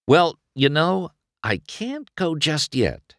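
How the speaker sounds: high, playful teasing tone. A narrator's reading. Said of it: "He smiled playfully".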